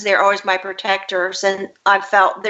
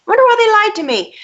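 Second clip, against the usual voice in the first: put-on much higher, fast little-girl voice; child's voice; 13 semitones higher, 4 dB louder, faster than usual. Girl voicing her childhood thought about her parents, high childlike. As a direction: squeak it out like a puzzled five-year-old.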